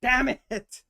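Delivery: loud, high curse at a mistake; frustrated outburst. Real, not acted. After a wrong guess in the puzzle: real angry shout.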